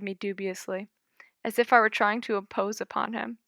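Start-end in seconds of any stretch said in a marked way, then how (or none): none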